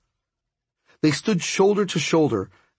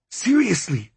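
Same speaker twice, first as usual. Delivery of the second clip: ordinary delivery, nothing marked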